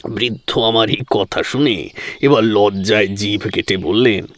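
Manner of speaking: usual speaking voice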